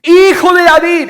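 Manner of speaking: very high, full-voiced cry; loud, soaring exclamation; exclaimed shout. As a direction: shout it out to someone far ahead. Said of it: "está en exclamación".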